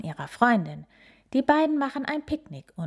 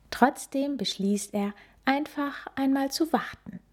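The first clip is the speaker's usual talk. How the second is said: ordinary delivery, nothing marked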